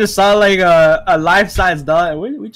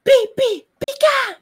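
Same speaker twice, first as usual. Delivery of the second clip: Pikachu voice; slower than usual. Pretend. Imitating Pikachu noises.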